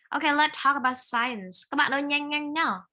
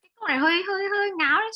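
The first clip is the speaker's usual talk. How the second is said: high, louder, cracking with laughter; laughing tease; 8 semitones higher, 5 dB louder, much faster than usual. Real, not acted. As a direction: tease the student while laughing. Teacher teasing silly sentence. (vừa cười vừa trêu, giọng cao)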